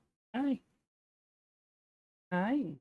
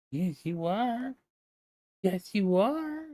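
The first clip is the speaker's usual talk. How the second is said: slow, drawn-out pet talk; 3 semitones higher, slower than usual. Real, not acted. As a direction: coo slowly to a beloved cat. Cooing baby talk to pet cat.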